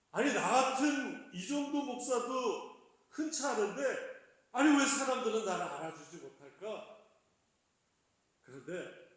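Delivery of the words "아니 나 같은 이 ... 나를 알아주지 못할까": arrogant. Retold or imitated. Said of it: "제가 참 교만하게".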